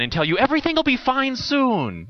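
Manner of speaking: lightly voiced chirpy cheerful optimist; mock the relentless cheerfulness; Mary Sunshine chirp. Preacher mocks a chirpy comforter, lightly cheery. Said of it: "little Mary Sunshine".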